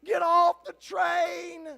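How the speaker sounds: slow, high, loud scream of warning. Acted. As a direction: yell a desperate warning. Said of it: "jumping up and down screaming".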